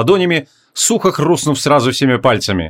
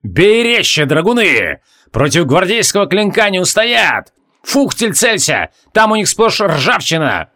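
battle orders; 5 semitones higher, slower than usual. A narrator's reading. Captain urging dragoons in battle.

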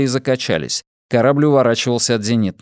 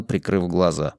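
plain reading voice

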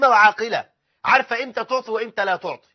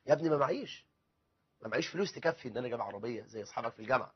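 low, much softer reply; apologetic father; 9 semitones lower, 13 dB quieter, faster than usual. Acted. Father telling his son there is no money, quietly apologetic. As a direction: plead lack of means, quietly.

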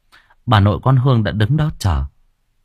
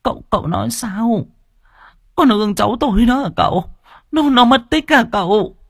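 put-on a higher, stammering panicked old woman; 13 semitones higher than usual. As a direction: gasp it out in alarm. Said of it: "bà nội hốt hoảng".

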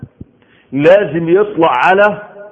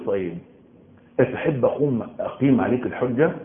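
quiet challenge; 5 semitones lower, 6 dB quieter than usual. Retold or imitated. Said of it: "قل لي".

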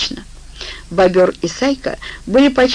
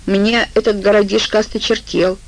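usual speaking voice

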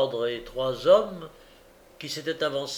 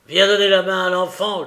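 loud, higher, shouted command; shouted order; 5 semitones higher, 10 dB louder than usual. A narrator's reading. Sikes yells at Toby to help with the boy, shouting. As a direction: bark the order at the accomplice. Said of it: "cria Sikes".

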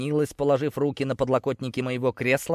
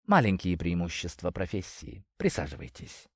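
low, soft seductive invitation; femme fatale; 4 semitones lower, 5 dB quieter than usual. Acted. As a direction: lure him in softly. Selia invites him in.

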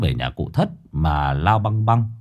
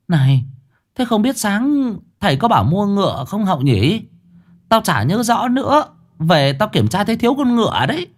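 put-on higher old woman's voice; old mother asking; 10 semitones higher than usual. Mother asking daughter about the paper horse, higher voice. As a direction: ask the daughter, a bit forgetful.

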